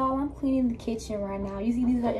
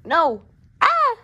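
pained yelp; 6 semitones higher, 8 dB louder than usual. Real, not acted. Kitten biting her hand.

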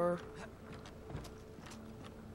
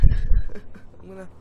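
laughing; 2 semitones higher, 16 dB louder, much slower than usual. Real, not acted. Laughing at own failure.

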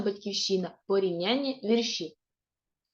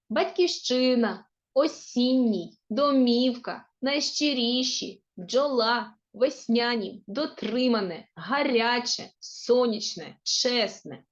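slow word-by-word reading, stressed syllable drawn out; teacher modelling correct stress, one careful word at a time; чіткий наголос у кожному слові; slower than usual. Shown as a demonstration. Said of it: "правильно поставивши наголос". (повільно, слово за словом, виразно виділяючи наголос)

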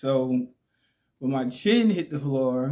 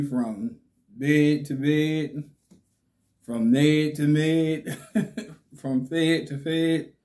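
slow, rhyming cadence; slow rhythmic; slower than usual. Real, not acted. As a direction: spell out the rhyme slowly. Rhyming thanks to wife.

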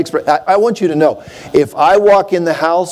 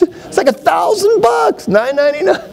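much higher, exclaimed price; mock shock at price; 13 semitones higher than usual. Real, not acted. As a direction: gasp out the price.

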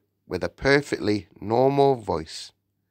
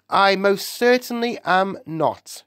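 high-pitched mild shout; indignant shout; 11 semitones higher than usual. A narrator's reading. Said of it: "he shouted".

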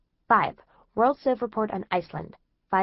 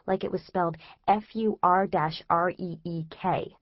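ordinary delivery, nothing marked

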